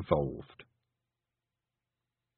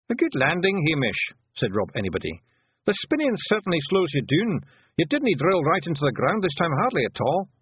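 higher Scots pixie voice, unbothered; Rob Anybody, Scots pixie; 8 semitones higher, 3 dB louder than usual. A narrator's reading. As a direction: brush off her worry in broad Scots. Rob Anybody teasing Hamish.

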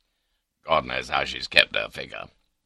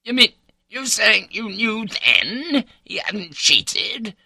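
slow, high, loud disbelief; incredulous; 5 dB louder, slower than usual. A narrator's reading. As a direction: draw it out, pitched up in astonishment. Husband stunned by wife's confession.

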